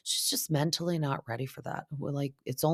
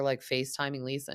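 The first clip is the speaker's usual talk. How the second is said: ordinary talk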